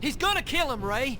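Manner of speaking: high, frantic cry; terrified plea for his brother; desperate plea. Acted. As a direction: beg in panic to stop the beating. Begging while brother is beaten.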